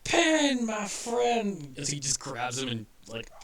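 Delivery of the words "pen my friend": high, loud, jolly cartoon porcupine; a big happy toon greeting the bar; cheery porcupine toon. Quincy hugs Pendle.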